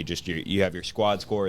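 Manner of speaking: normal talking voice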